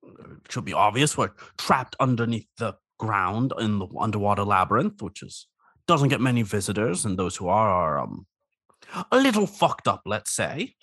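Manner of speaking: lightly voiced lonely crab; a hint of a needy crab; crab character voice.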